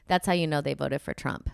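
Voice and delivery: ordinary talk, nothing marked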